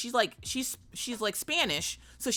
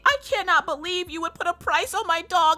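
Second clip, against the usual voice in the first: high, loud mock hysterics; hammed-up outraged, panicking dog owner; losing her mind; 10 semitones higher, 6 dB louder than usual. Owner protests the price to receptionist, mock hysterical. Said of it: "she was losing her flipping mind".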